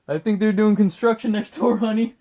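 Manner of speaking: hammed-up shout to the house; mock calling out. Husband calling to his wife, mock casual shout.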